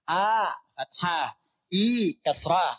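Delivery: a and i drawn out slowly; slow vowel demo. Shown as a demonstration. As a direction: say each vowel slowly and clearly. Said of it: "contoh harakat". (a dan i diucapkan pelan dan jelas)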